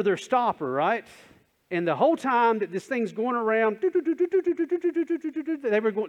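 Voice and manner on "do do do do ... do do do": high sing-song do do do, like a ticking game board; board spinning noise. Retold or imitated. Preacher mimics the game show board going round, high chanted do do.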